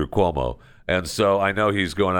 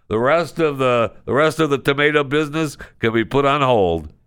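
insisting in a higher, raised voice; raised insistent take; 7 semitones higher than usual. Shown as a demonstration. Host ranking Heinz ketchup above the tomato business, with raised insistence. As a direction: push the point up high, mock indignant.

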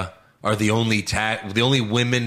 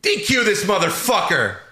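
indignant referee; 6 semitones higher than usual. Retold or imitated. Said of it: "then the ref goes".

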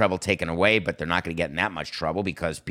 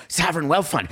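mocking Gary Bettman; 8 semitones higher than usual. Sports host mocking NHL commissioner, high gleeful voice. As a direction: ham up a gleeful league boss savouring the words. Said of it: "Gary Bettman said".

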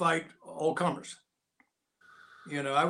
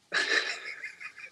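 breathy laugh. Real, not acted. Laughing at his own story.

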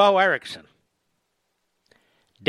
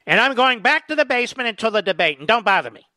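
mock Biden retreating; 7 semitones higher, 5 dB louder than usual. Host mocks Biden retreating.

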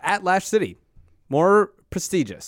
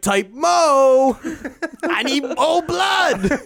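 vampire coach voice; 9 semitones higher, 4 dB louder, much slower than usual. Retold or imitated. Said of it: "I'm like".

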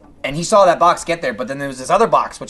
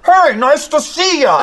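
hearty greeting; 11 semitones higher, 6 dB louder, slower than usual. Retold or imitated. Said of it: "every fucking single person from Funhouse was like".

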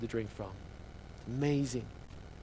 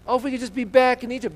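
high, loud moan of longing; mock whining; 8 semitones higher, 6 dB louder, faster than usual. Israelites longing for Egypt, mock whining. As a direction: wail it like a hammed-up complainer. Preacher replays the whiny complaint.

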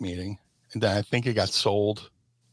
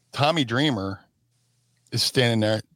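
usual speaking voice

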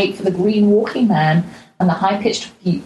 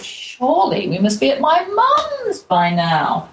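higher, slowed-down sigh of a thought; weary inner thought; 8 semitones higher, slower than usual. Retold or imitated. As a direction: drag it out like you're fed up walking. Weary thought on a long trek.

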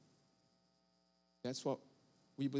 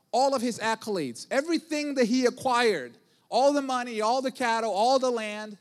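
preaching build; 5 semitones higher, 5 dB louder than usual. Real, not acted.

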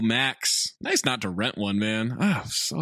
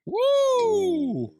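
excited whoop. Real, not acted. Cheer at Gigli mention.

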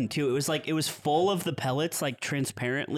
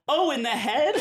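put-on high, louder kid voice through a laugh; scared kid; 10 semitones higher, 4 dB louder than usual. Frightened kid hearing he shot the driver. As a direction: act shocked like a caught child. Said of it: "he's really scared".

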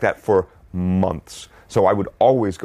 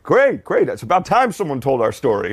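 excited; 5 semitones higher, 3 dB louder than usual. Retold or imitated. Twins quoted praising.

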